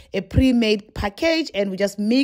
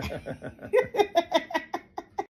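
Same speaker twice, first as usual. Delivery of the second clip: high soft laugh; light giggle; 8 semitones higher, 6 dB quieter than usual. Real, not acted. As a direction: laugh at the Russian joke. Laughs at own joke.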